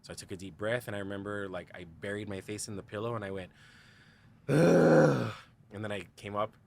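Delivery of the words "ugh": loud, high groan of dread. Retold or imitated. Face in the pillow before coming out, a loud anguished groan.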